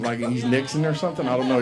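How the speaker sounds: ordinary talk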